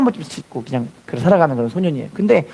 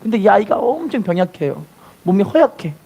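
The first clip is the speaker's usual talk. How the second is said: ordinary delivery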